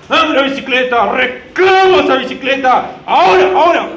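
put-on high, loud shouted demands of a bratty kid; child's tantrum. Daughter demanding a bicycle from dad, hammed-up shouted tantrum. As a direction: yell your demands like a child mid-tantrum. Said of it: "Es lógico verlo como un berrinche".

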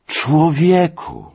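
high, drawn-out exclamation; appeal to conscience. Acted. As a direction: appeal to him, stretched out and high. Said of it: "rzekłem, przemawiając do jego sumienia". (wysokie, przeciągłe napomnienie)

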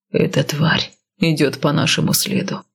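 low, grim man's voice; gruff warrior, low and steady; stern northerner. Acted. Male lead voiced lower.

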